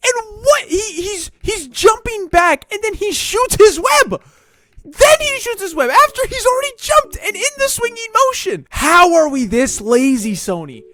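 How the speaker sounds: real exasperated shouting; worked up. Real, not acted. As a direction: rant at the screen, voice cracking high. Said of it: "I'm getting too worked up".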